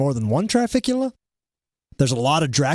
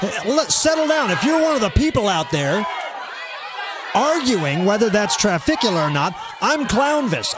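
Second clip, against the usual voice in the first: shouting; 4 semitones higher than usual. Real, not acted. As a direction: yell over the chatter. Host shouts to commenters.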